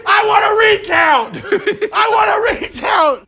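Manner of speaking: high, loud, laughing shout; loud protest through laughter; demanding a recount. Real, not acted. Protesting the game result, a laughing loud shout. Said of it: "'Cause he did swallow it".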